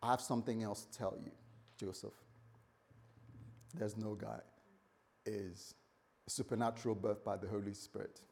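calm him down with a hushed truth; Mary quietly telling Joseph the truth; soft explanation. Acted. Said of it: "And she says".

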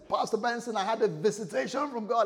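high-pitched, breaking big news; excited. Acted. Abraham messaging his pastor about a visitation, excited. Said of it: "And Abraham is excited".

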